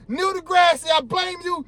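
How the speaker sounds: high-pitched angry call-out; angry shout. Real, not acted. Vlogger blaming Neil deGrasse, angry and high. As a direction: point the finger and yell. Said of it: "Sorry for getting mad".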